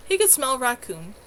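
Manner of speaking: usual speaking voice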